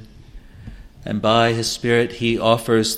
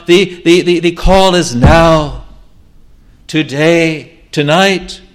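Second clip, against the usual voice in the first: loud, high, urgent exhortation; preaching at full force; 6 semitones higher, 7 dB louder than usual. Acted. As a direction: drive every phrase home, slowing on the commands.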